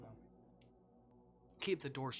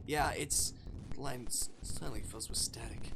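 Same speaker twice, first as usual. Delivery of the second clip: ordinary talk, nothing marked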